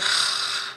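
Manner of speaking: long weary exhale; exasperated sigh. Acted. Recognizing Stanley's voice on the phone, exasperated sigh. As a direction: sigh heavily before answering. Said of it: "So I was like".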